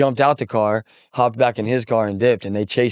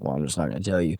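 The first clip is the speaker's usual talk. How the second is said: quiet refusal; 5 semitones lower, 7 dB quieter, faster than usual. Retold or imitated. Refusing to tell the police.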